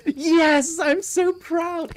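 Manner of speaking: high, slow, loud gush of pride. Real, not acted. Praising a friend for saying it correctly, gushing. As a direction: beam with exaggerated pride.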